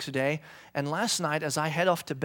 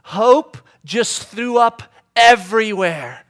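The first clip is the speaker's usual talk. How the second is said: slow, loud, higher alarmed wife; wife's alarmed report; 4 semitones higher, 10 dB louder, much slower than usual. Retold or imitated. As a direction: drag it out like a panicked wife on the phone. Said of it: "And she goes".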